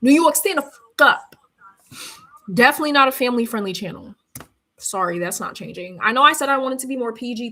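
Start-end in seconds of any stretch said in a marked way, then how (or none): 0.0-1.4 hype shout. Acted. Hype call to the crowd.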